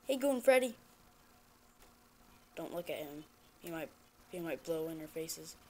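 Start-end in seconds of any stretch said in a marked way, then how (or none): 2.6-5.6 quiet nervous warning; hushed. Real, not acted. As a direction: keep it down so he won't hear. Hushed warning about animatronic.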